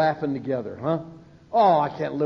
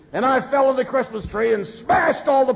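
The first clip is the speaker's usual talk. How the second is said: higher, louder mock shout of the punchline; speaker's punchline yelled; 8 semitones higher, 4 dB louder than usual. Podium speaker yelling his funny drunk story, mock shout. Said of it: "he says".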